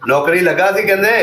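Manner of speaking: loud, high-pitched repeat for a listener. Real, not acted. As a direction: call the question across clearly.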